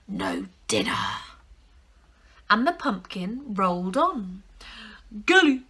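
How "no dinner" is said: deep whispered growl; tiger voice. Acted. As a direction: grumble low like a hungry tiger. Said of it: "said the tiger".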